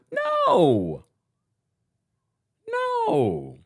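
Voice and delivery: very high, drawn-out emphatic no; mock incredulous no. Vlogger scoffing at cancelling a Vogue shoot, squealing no. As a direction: squeal a scandalised no. Answering own rhetorical question.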